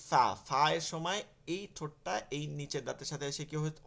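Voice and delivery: ordinary talk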